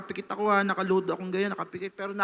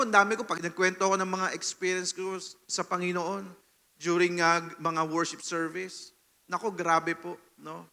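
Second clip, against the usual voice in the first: ordinary talk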